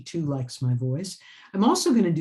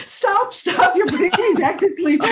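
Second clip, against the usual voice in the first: high, loud laughing cry; laughing protest; 10 dB louder than usual. Retold or imitated. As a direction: squeal it through laughter. Editor laughing, retold protest.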